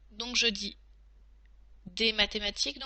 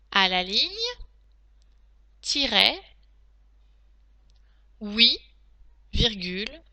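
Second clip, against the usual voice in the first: dictating. Shown as a demonstration. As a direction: dictate each phrase clearly to pupils. Teacher dictating to class.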